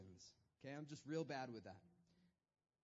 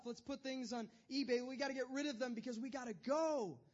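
much higher, louder frantic rush; flustered husband; 7 semitones higher, 5 dB louder than usual. Retold or imitated. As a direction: babble the plans in a panic. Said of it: "And she's like".

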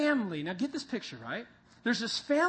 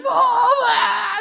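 high, loud, laughing mock scream; retelling the kids' scream with a laugh; kids screaming; 12 semitones higher, 12 dB louder than usual. Kids in the chaos screaming for mom, mock scream. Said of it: "The kids are screaming".

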